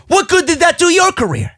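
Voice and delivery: high-pitched scornful challenge; mocking question. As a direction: throw the question up high in mock disbelief. Mocks wrestler's boast.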